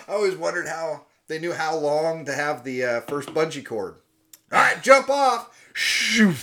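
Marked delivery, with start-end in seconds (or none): 4.5-6.3 high, loud hammed-up command; mock shouted order. Bungee tester ordering the jumper, loud mock call. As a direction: bark the order for laughs.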